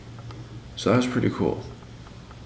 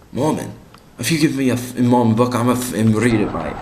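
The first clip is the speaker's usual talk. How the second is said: brash English bloke, high and loud; English accent; 4 semitones higher, 6 dB louder than usual. Retold or imitated. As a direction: imitate an emphatic Englishman. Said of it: "I'm from England".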